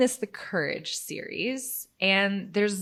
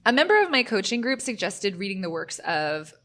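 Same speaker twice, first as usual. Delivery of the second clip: usual speaking voice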